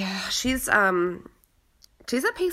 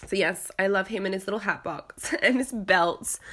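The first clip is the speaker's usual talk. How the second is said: ordinary talk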